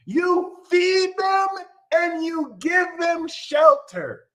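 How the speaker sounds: put-on child scolding a parent in a high, slow voice; high, slow mock child voice. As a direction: squeak it out like a smug toddler.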